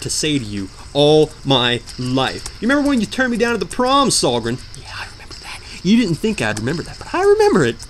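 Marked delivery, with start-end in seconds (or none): 2.6-4.6 high, fast wounded accusation; hammed-up heartbroken reproach; mock jilted lover. Mock melodrama at the dev. 4.8-5.6 hushed, voiceless grudge; mock bitter whisper. Melodramatic aside. 7.1-7.8 very high, strained melodrama; hammed-up wail. Melodramatic rant.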